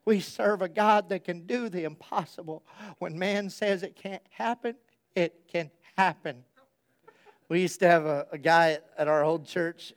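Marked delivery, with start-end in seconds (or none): 0.1-6.4 soft old-lady impression; old woman's voice. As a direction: counsel a friend as a quiet elderly woman. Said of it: "she says it in her old voice".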